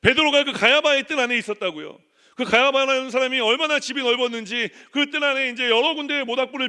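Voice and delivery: loud, high, driving sermon delivery; preaching at full force. Acted. Pastor preaching hard to the church, loud and urgent.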